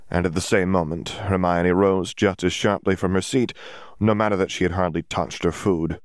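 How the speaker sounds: ordinary delivery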